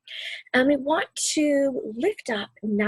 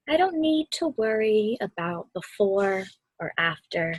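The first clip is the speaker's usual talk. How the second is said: calm storybook reading; 2 semitones lower than usual. A narrator's reading. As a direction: read it gently, letting each word settle. Reads the book's calm ending.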